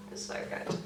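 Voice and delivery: breathy whisper of delight. Real, not acted. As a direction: whisper it like a secret treat. Gushing about an eyeshadow.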